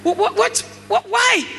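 high, rising mock outrage; mock indignant what. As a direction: sputter the whats in amused disbelief. Mocking the forward girl.

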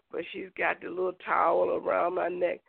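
usual speaking voice

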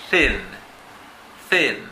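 THIN said with a short, crisp i; short vowel demo. Shown as a demonstration. Teacher models short vowels for English learners, short vowel demo. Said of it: "el sonido E es corto".

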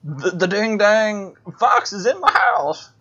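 put-on high, slow old codger voice; grumpy old prospector complaining. Badger voice again.

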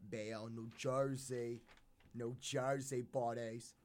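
slow, drawn-out New Jersey accent. Shown as a demonstration. Band member showing off hometown speech, drawn-out Jersey accent. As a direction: stretch it out in a put-on Jersey accent. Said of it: "That's my, uh, Jersey accent".

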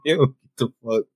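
ordinary talk

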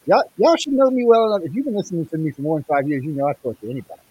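muffled mic, bass only. Real, not acted. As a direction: sound muffled through a bad mic. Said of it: "You sound muffled".

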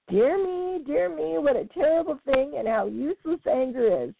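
put-on high, fussy old frog voice; tut-tut like a wise old grandfather; old frog tutting. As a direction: Grandfather Frog clucks over the fight. Old frog sighs over fight.

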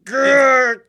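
high, loud, strained grunting shout. Acted. Writer choking his boss, strained shout. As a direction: push it out mid-strangle.

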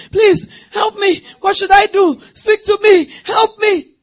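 loud, high begging for help; desperate pleading. Retold or imitated. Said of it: "begin to make phone calls".

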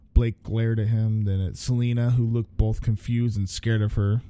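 usual speaking voice

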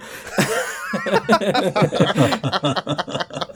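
high burst of laughter; laughing. Real, not acted. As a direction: laugh at the cover joke. Laughing at own joke.

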